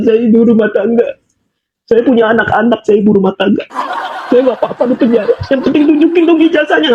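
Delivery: mocking someone crying for sympathy; put-on sob story, derisively imitated; mock tearful pleading. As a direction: imitate a whining, tearful plea. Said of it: "Sekarang nangis-nangis ngapain?".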